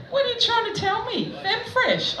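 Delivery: very high-pitched acted challenge; mock indignation. Comic confronting him about Femfresh, high-pitched mock indignation.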